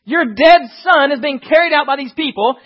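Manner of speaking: normal talking voice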